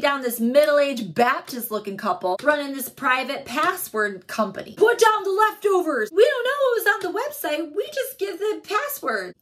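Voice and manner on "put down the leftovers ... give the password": high, loud, tearful wail; panicked crying plea. Acted. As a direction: beg in a hammed-up panic. Panicking suspect during a raid.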